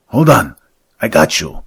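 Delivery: loud, high cry to a falling partner; urgent shout. Acted. Hastings catching his falling partner, urgent shout. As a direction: call out as you grab him.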